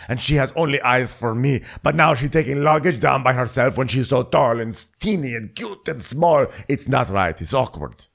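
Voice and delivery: put-on voice of a forlorn suitor; mock lovelorn complaint, pitch sinking at the end; Andrea. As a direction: lament it in an imitated lilting voice. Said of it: "and he's saying".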